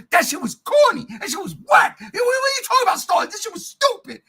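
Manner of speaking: high, loud mock-outraged reenactment; retold angry protest. Host retelling his rebuke to a coworker, outraged. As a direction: replay the argument with pitch shooting up. Retold heated argument at work.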